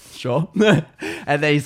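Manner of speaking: ordinary talk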